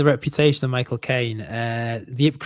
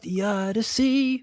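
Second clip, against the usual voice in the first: cartoon announcer; 8 semitones higher, slower than usual.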